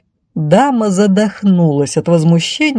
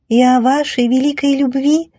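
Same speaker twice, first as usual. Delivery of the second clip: high, thin dreamer; dreamy piping from the crowd; dreamy little voice; 7 semitones higher than usual. Acted. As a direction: muse aloud in a high, wistful voice. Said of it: "мечтательно добавил всё тот же голосок".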